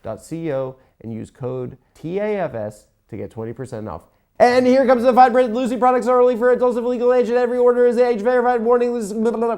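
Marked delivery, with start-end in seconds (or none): 4.4-9.5 rapid-fire disclaimer, pitched up; fast fine print. Shown as a demonstration. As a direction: rattle off the legal text like an ad. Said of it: "I can't do the fast thing".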